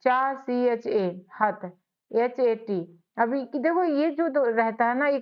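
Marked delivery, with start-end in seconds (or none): 0.0-2.8 slow, split into syllables and letters. Shown as a demonstration. Teacher splitting चाहत into syllables for students. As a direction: break the word apart slowly. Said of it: "अब देखो, चाहत".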